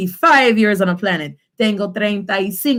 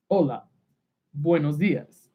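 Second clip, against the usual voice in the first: low, soft mock-male greeting; deep-pitched, subdued put-on voice; masculine voice; 6 semitones lower, 6 dB quieter than usual. Shown as a demonstration. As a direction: greet quietly as the man in the role-play. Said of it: "a masculine sounding voice".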